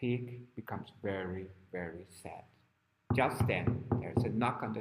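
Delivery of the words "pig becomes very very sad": low, sinking, hushed storytelling; softly downcast, trailing lower; sad narration. A narrator's reading. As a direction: let the voice drop sorrowfully. Narrating the pig's sadness.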